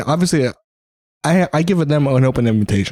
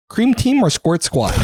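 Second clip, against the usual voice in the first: laughing; 13 semitones higher, slower than usual. Real, not acted. Teasing question, laughing.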